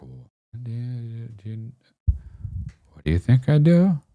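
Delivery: high, halting start, hushed then blurted; nervous stammer. Retold or imitated. Kid caught out answering his accuser, nervous and high-pitched.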